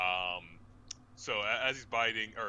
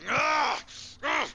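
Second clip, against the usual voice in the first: pained yelp; 14 dB louder than usual. Acted. Barbarian bitten, recoiling.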